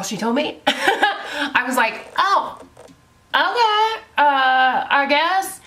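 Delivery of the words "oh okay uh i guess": high, unsure "okay" trailing into a shrug; bewildered okay. Retold or imitated. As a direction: caught off guard and not convinced. Told only start/stop buttons.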